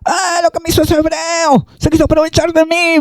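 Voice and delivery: high, false outcry of a wronged woman; mock screaming accusation. Potiphar's wife falsely crying out after José fled, high mock wail. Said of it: "empezó a gritar".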